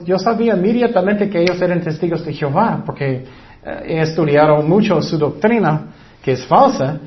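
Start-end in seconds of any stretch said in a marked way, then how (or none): none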